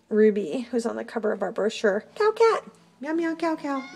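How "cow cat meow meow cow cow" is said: much higher cooing at the cat. Real, not acted. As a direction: coo the nickname high. High pet talk.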